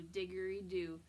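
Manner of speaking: plain reading voice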